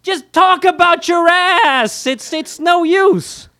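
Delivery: high, drawn-out exasperated yell; loud, high-pitched desperate holler; screaming at the radio. Acted. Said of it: "I scream to the radio".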